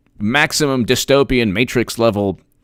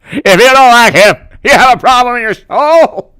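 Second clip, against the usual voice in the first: high and loud mock preaching senator; loud, high mock-sincere sermonizing; hammed-up senator; 11 semitones higher, 7 dB louder than usual. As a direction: ham up a fawning politician's outburst. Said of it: "Lindsey Graham".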